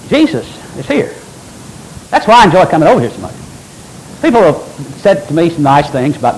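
usual speaking voice

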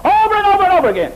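very high, fast and loud; exaggerated sing-song of endless repetition; exasperated mock recital. Preacher mocks repetitive funeral praise.

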